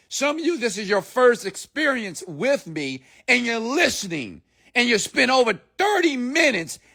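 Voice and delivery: high-pitched, insistent sermon delivery; impassioned motivational preaching. Acted. Coach challenging hesitant listeners to grab the wheel, impassioned preaching. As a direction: preach it high, leaning on key words.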